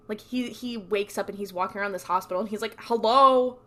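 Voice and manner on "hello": louder, higher retold call. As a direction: imitate calling down an empty corridor. Dean calls through empty hospital.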